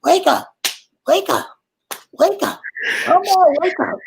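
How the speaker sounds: hammed-up aunt begging the dead to rise; imitating the tipsy aunt. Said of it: "and she gonna".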